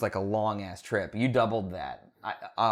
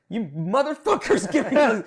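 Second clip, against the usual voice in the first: mock outraged yell; 10 semitones higher, 4 dB louder than usual. Retold outrage at server.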